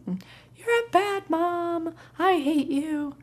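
high, drawn-out mock kid yell; mock tantrum insults; screaming four-year-old. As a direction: imitate an angry child lashing out, high-pitched. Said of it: "he screamed for a while".

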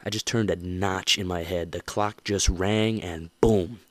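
ordinary talk, nothing marked

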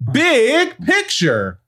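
high, slow drawn-out announcer call. Acted. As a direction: stretch the segment name for effect. Announcing segment title.

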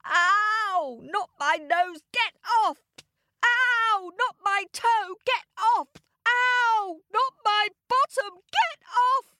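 very high-pitched comic yelping; hammed-up wails of pain; wailing stung guards. A narrator's reading. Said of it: "all those guards sobbing and wailing about their stings".

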